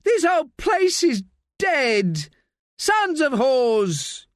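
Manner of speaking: put-on high, drawn-out Alpujarreño-accented yell; shout in Alpujarreño accent. A narrator's reading. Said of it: "in a filthy Alpujarreño accent, he shouts".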